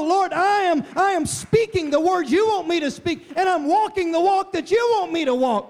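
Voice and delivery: high, loud preaching climb; impassioned sermon peak; fiery preaching. Acted. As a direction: preach it full force, building. Preaching at full force.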